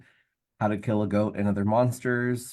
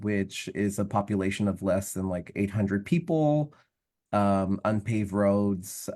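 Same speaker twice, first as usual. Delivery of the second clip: ordinary delivery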